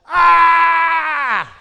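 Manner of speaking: piercing high shriek; wailing one-year-old shriek; toddler scream. Retold or imitated. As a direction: let out a full tantrum scream. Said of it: "she just screamed".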